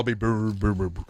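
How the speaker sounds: slowed-down low mock beat; slow low brr. Host imitating slowed-down Chechen rap, low mock beat. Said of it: "a much slower".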